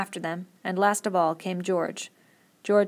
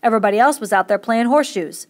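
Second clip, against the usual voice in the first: much louder, heated accusation; angry outcry; 3 semitones higher, 10 dB louder than usual. A narrator's reading. Curly blaming Lenny to the gathered men, angry and loud. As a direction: hammer the blame home. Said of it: "he cried".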